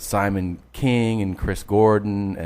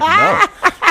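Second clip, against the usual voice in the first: high, loud retold no through laughter; laughing mock refusal; 6 dB louder than usual. Comic retells bar staff refusing the song, laughing. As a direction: blurt the refusal while cracking up.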